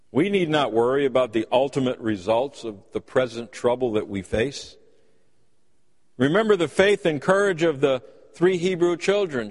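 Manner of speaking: ordinary delivery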